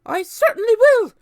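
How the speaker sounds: very high, loud snap; snapped. A narrator's reading. Aunt Spiker snapping her answer, high and loud. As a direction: bite the words off. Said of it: "Aunt Spiker snapped".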